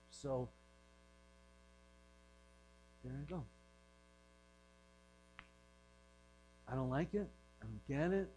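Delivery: soft, low, halting concession; reluctant acceptance. Retold or imitated. Disciples answering Jesus' hard saying, reluctantly resigned. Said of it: "is with this inflection".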